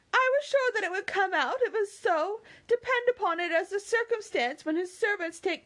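high, gushing excitement; excitable Mary. Acted. Mary to Anne about Sir Walter's heir, excited. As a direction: chatter on, thrilled and emphatic.

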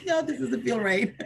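laughing high refusal. Retold or imitated. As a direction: laugh through the words. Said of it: "I was like".

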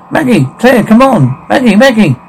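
high-pitched urging call; urgent calling. Acted. Urging them to come along.

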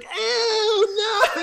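high loud squeal of disgust; imitated disgusted squeal. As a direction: squeal like a grossed-out kid. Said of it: "And he was like".

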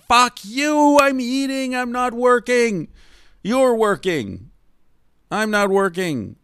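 high, loud mock outrage; indignant retort. Ranting reply to the waiter.

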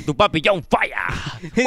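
slow, drawn-out self-important signature; a lightly voiced cocky donor signing off; mock grand sign-off. Streamer reading a donor's boastful sign-off, slow and mock-grand.

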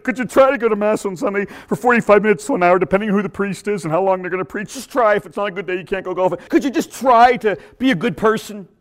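high, put-on cartoonish pleading; pleading purple dinosaur. Dinosaur in the sky coaxing churchgoers, high mock pleading. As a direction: beg timidly in a lifted mascot voice. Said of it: "great big purple dinosaur in the sky that says".

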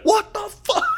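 high, laughing disbelief; stunned. Retold or imitated. Fan reacting to a missed field goal, high laughing disbelief. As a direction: shriek it in laughing shock.

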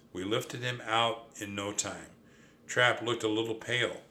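ordinary delivery, nothing marked

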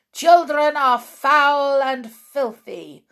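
loud, high witch boom; thundering witch. Acted. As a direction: thunder it at the crowd. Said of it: "thundered the Grand High Witch".

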